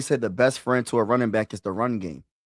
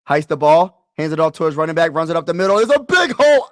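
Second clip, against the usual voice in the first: excited call climbing to a high shout; live play-by-play; 5 semitones higher, 9 dB louder, faster than usual. Real, not acted. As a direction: build loudly as the hole opens.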